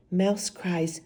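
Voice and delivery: ordinary delivery, nothing marked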